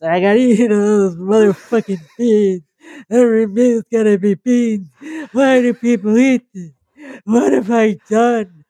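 lamenting with broken teeth; talking funny. Acted. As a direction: moan it through broken teeth. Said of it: "they're talking funny 'cause they've broken their teeth".

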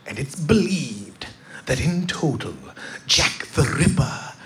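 slow, hushed, breathy British guide voice; scary British whisper. Retold or imitated. Tour guide revealing the climax to the group, slow spooky British whisper. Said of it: "scariest British voice".

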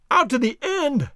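slow, high-pitched exclaimed question; mild scream. A narrator's reading. Treasure hunter echoes servant's news, mild high scream. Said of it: "here fairly screamed Legrand".

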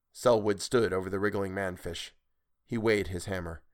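ordinary delivery, nothing marked

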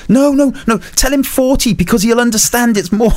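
panicky woman. Retold or imitated. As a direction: squeal it like the most scared passenger in the corner. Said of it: "She went".

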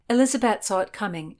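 ordinary delivery

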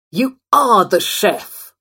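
raging, drawn-out shout; angry bark. A narrator's reading. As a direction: bark it in a rage, leaning hard on "are". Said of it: "he barked".

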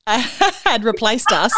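laughing it out slowly; amused, laugh through the words; slow giggle through the line. Real, not acted. Laughing about being replaced.

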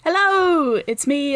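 ordinary delivery

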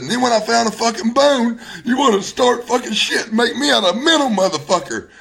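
high, distraught outpouring; real anguish. Real, not acted. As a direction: protest bitterly, pitch climbing high. Distraught listing what he lost.

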